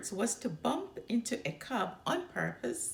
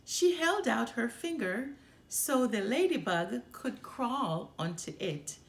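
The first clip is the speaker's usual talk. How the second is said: ordinary delivery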